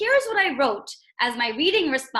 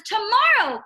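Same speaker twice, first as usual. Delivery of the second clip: higher and louder cry; cried out; 8 semitones higher, 7 dB louder than usual. Retold or imitated. Teacher rereading Toad's cry, higher and louder. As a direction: cry out the word, exasperated. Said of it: "cried Toad".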